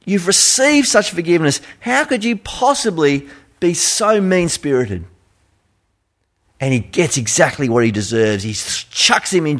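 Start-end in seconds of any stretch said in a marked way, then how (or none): 0.0-5.1 incredulous king. Retold or imitated. King confronting the mean servant, high disbelief. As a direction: stretch out the first word in disbelief. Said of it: "He says".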